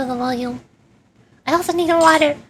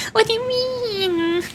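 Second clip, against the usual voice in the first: laughing; 9 semitones higher, much slower than usual. Real, not acted. Laughing after own attempt.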